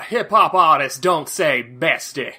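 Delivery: ordinary talk, nothing marked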